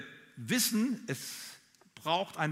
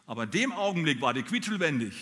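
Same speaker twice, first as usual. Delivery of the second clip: usual speaking voice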